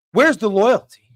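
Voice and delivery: outraged. Real, not acted. Ranting at betrayal by friend.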